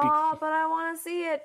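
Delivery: imitated childish begging, pitch lifted; acted pleading. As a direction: plead like a kid told no. Acting out their pleading reply.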